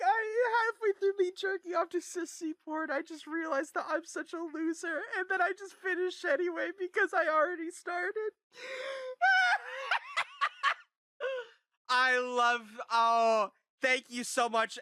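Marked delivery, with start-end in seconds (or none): 0.1-8.3 high, laughing mock of the guy; laughing mock quote. Streamer mocking the video guy's confession, laughing high. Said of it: "he's like". 8.5-11.9 high-pitched, laughing through the words; can barely get it out for laughing; crying with laughter. Real, not acted. Streamer retelling the clip, cracking up with laughter. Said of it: "I'm fucking crying".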